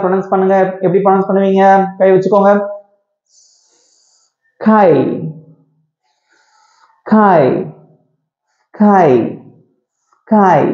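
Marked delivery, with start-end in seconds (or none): 4.7-10.6 long hissed s, then 'கை'; S sound first. Shown as a demonstration. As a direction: hold the s apart before each 'கை'. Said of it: "அந்த sound முதல்ல வரணும்". ('ஸ்ஸ்' முதலில், பிறகு 'கை')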